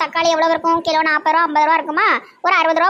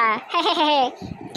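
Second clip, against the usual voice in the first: laugh; 5 dB quieter than usual. Real, not acted. Laughs after remark.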